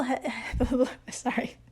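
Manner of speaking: breathy sorry through a laugh; real laughter. Real, not acted. Podcaster losing it mid-explanation, laughing apology. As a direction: apologise while trying not to laugh. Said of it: "I don't know why I'm laughing".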